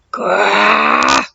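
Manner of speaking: low dinosaur growl. Acted. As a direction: growl like a beast before the roar. Terrifying roar fills the air.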